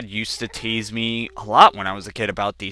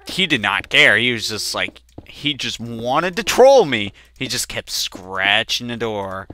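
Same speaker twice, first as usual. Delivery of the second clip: ordinary talk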